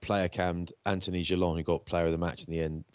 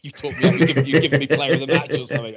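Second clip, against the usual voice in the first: laughing while quoting; 4 semitones higher, 7 dB louder, faster than usual. Retold or imitated. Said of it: "sent me a text saying".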